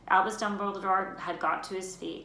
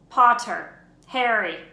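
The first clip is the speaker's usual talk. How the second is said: calling Harry's name; 3 semitones higher, 11 dB louder, slower than usual. Acted.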